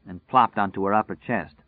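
ordinary delivery

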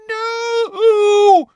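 very high, loud drawn-out shriek; Umbridge shrieking. A narrator's reading. Captive shrieking at the centaurs who seize her, shrill shriek. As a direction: shriek it at the top of your range. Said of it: "he heard Umbridge shriek".

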